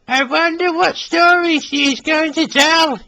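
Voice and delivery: high, eager little engine wondering; dreamy wonder, pitched high; high, excited musing; excited Billy. Acted. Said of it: "he thought to himself".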